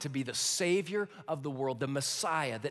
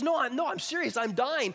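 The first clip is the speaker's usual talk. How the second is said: insisting Esau; 6 semitones higher, 4 dB louder than usual. Acted.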